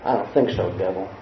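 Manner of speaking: low, quiet dismissal; low, unbothered father voice; calm, firm father. Acted. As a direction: turn the devil away softly but firmly. God dismissing the devil.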